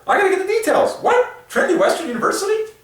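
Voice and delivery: can't believe what she's reading; high, excited disbelief; astonished. Real, not acted. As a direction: pitch shoots very high, quick and disbelieving. Reading news, recognises her own school.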